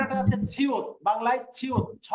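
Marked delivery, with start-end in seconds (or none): 2.1-2.1 "ছ" as an aspirated chh, as at the start of ছয়; pronounced as in ছয়. Shown as a demonstration. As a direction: sound out "ছ" alone, like the first sound of ছয়. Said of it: "ছয়ের মতো". ("ছ" ছয়ের ছ-এর মতো উচ্চারণ)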